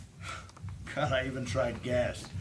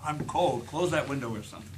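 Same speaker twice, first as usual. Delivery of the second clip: ordinary talk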